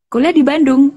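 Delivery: high, loud surprised question; sudden astonished disbelief. Real, not acted. Hearing he studied in Bandung.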